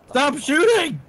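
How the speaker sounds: high, exasperated cry; protesting yell. Real, not acted. Player to teammate who keeps shooting, protesting yell. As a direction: cry out for them to stop.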